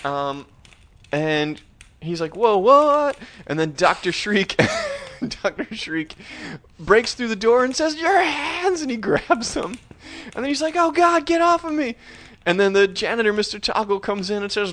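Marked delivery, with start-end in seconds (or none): 2.4-3.2 high, loud acted gasp of shock; startled disbelief. Boy discovering severed hands playing pianos, loud high astonishment. 8.0-8.8 high, hammed-up crazed cry; manic shriek. Crazed teacher bursting through the door, manic high shriek. 10.8-12.0 high mock-terrified squeal; panicked cry. Boy seized by his crazed teacher, high mock panic.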